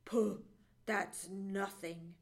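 low, slow modest boast; deep, unhurried dismissal; Johnny Chuck. A narrator's reading. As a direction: brush it off in a low voice. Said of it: "replied Johnny Chuck".

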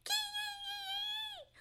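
very high puppy squeals; high kee-yee wails; hurt dog's yelping. A narrator's reading. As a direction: yelp in pain like a small dog. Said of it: "those teeth hurt dreadfully".

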